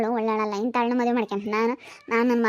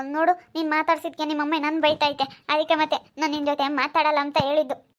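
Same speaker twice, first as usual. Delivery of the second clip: ordinary delivery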